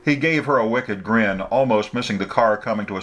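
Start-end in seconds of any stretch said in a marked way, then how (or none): none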